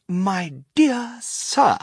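very slow, high, loud greeting; lavish, stretched-out salutation; effusive Mister Wonka. A narrator's reading. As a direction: draw each word out grandly.

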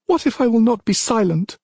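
high-pitched defiant challenge; defiant princess. Acted. Princess refuses to be silenced, high and defiant. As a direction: throw the question back in a high voice.

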